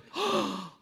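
sudden audible intake of breath; sharp gasp. Acted. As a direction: gasp as if caught out. Reacting to the Lord's challenge.

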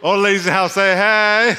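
loud, high hype-man shout; rally the room with a big yell; party-style call to the audience; crowd call-out. Real, not acted.

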